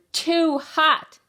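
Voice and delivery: high, loud, stretched whine; exaggerated complaint. A narrator's reading. Child at supper with the peas, exaggerated complaint. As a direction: lean hard on both words.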